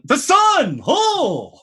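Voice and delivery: high, loud burst of awe; dazzled shout. Acted. Game master plays Moroccan distracted by the sun, awestruck shout.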